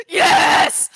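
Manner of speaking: real burst of laughing cheer; excited shout. Real, not acted. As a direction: whoop like your team just won. Cheering at football thought.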